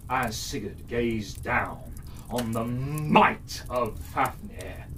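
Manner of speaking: usual speaking voice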